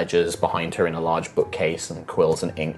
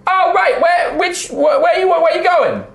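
high, loud halfling voice; halfling worker; 5 dB louder than usual. Acted. Halfling worker hails visiting strangers, high shouted voice. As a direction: call out high over factory din.

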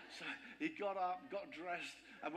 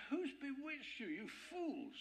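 mock furious; 9 semitones higher, slower than usual. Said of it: "He's furious".

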